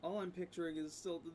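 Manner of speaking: normal talking voice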